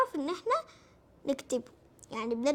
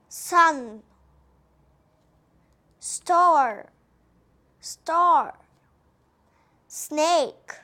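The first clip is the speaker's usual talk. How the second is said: each word called out louder, once then again; teacher drilling each word twice, clear and louder; 7 dB louder, much slower than usual. Shown as a demonstration. Teacher to kids, calling out each English word twice. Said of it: "ننطقها صح".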